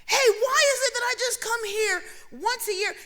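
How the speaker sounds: indignant patient calling out to the doctor. Retold or imitated. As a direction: shout it across the room, half laughing. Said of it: "I said".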